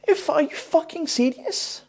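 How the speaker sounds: high, incredulous disbelief; real disbelief at the ending; incredulous outburst. Real, not acted. Ranting at viewers about the ending, incredulous.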